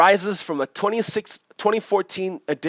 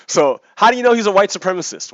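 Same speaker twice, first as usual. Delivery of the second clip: usual speaking voice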